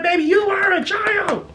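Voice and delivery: put-on high put-down to a child; condescending. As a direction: dismiss the kid sweetly.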